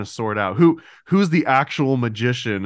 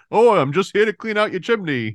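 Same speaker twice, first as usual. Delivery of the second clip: high, hammed-up Cockney chimney sweep; jaunty fake Cockney, pitched well up; mock Cockney; 8 semitones higher than usual. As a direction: imitate a bad movie Cockney accent. Mocks the film's Cockney chimney sweep.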